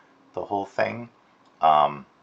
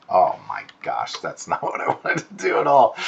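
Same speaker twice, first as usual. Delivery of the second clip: amused oh-my-gosh with a laugh; startled laugh; 5 dB louder than usual. Real, not acted. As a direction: catch yourself laughing at your own slip. Drawing stroke goes wrong.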